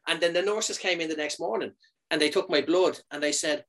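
usual speaking voice

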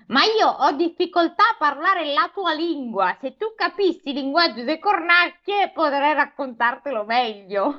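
high-pitched storybook crow; high talking-bird voice; crow character. Acted. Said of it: "disse la cornacchia".

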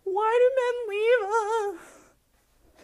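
high, slow, hammed-up sob; mock crying. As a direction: whine it like a fake weepy breakdown. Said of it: "we're literally crying over here like".

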